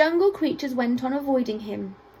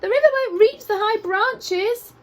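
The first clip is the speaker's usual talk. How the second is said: high monkey call; 9 semitones higher, 4 dB louder than usual. A narrator's reading. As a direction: call down to the animals from the treetops. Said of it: "called the monkeys".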